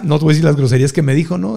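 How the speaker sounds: ordinary talk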